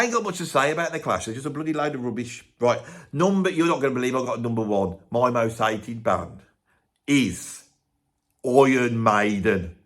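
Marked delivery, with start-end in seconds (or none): 5.1-9.8 slow, drawn-out countdown announcement; dramatic reveal. Real, not acted. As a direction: spell out the verdict slowly, word by word. Announcing his number one hated band.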